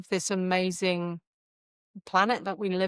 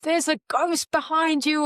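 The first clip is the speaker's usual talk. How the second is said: screaming warning; 9 semitones higher than usual. Pretend. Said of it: "what would they be screaming out at you".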